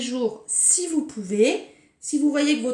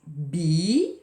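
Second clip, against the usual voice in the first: Bi-gou-di split into three slow beats; syllable by syllable; 6 semitones lower than usual. Shown as a demonstration. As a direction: tap out Bi-gou-di one syllable at a time. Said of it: "Et je vais vous demander". (Bi-gou-di détaché syllabe par syllabe)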